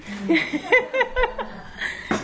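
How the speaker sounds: a bright, high burst of real laughter. Real, not acted. Laughing at her husband's ninety-year-old joke, a high real laugh.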